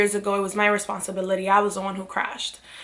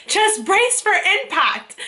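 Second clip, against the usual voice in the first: mock dramatic warning; 11 semitones higher, 4 dB louder, slower than usual. Bracing friend before jet ski lands.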